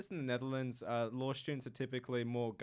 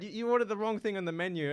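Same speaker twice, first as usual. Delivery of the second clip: ordinary talk, nothing marked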